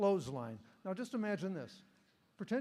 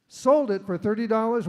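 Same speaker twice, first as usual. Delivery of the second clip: loud auctioneer call; 4 semitones higher, 12 dB louder than usual. Real, not acted. Closing the auction sale.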